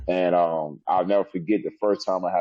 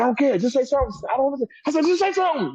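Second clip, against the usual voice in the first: impatient insisting; faster than usual. Retold or imitated. Demanding she provoke him.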